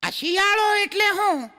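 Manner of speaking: put-on child voice. Small son asking his father, in a high child's shout. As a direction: pipe up like a curious kid.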